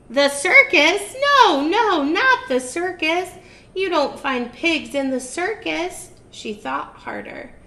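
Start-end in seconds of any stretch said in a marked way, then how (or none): 0.1-6.1 high, loud, dismissive witch; scoffing witch. Acted. Witch scolding herself about the circus. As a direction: scoff at your own idea.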